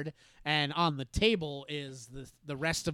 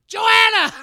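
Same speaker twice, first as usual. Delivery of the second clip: mom yelling the name, much higher and louder; yelling across house; 16 dB louder than usual. Retold or imitated. Mom calling her daughter to the phone, yelling. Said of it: "yelling across the house".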